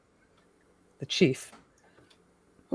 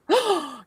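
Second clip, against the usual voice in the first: mock gasp; 10 semitones higher than usual. Quiz answer reveal.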